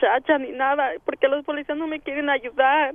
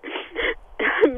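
real muffled sob. Real, not acted. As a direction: sob as you start to speak. Mother of missing son.

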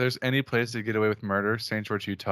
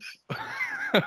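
high-pitched giggle burst; high squealing laugh; 3 dB quieter than usual. Real, not acted.

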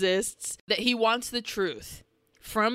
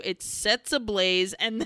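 ordinary delivery

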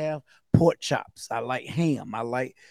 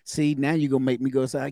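ordinary delivery

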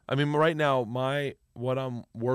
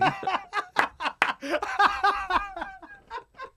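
big laugh. Real, not acted. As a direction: crack up laughing. Loud laugh at own story.

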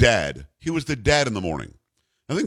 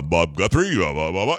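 deep bass; 5 semitones lower than usual. Retold or imitated. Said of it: "Bob was like a, like a subcontra bass".

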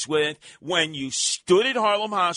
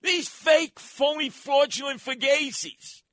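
indignant outburst; 7 semitones higher, slower than usual. Real, not acted. Host rants about officials.

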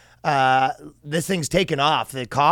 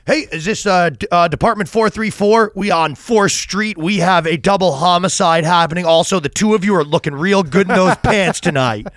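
hammed-up dispatcher; 4 semitones higher, 5 dB louder than usual. Comedian mocks a flirty cop dispatcher, hammed-up radio voice.

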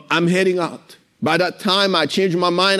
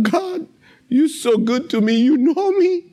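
higher, half-choked cry to God; retold tearful thanks, pitched up; tearful prayer; 8 semitones higher than usual. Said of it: "I'm in tears".

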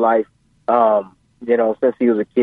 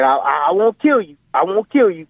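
lightly voiced dad, pitch raised; dad promising; 7 semitones higher than usual. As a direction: insist on it as the imitated father. Retold dad's threatening promise.

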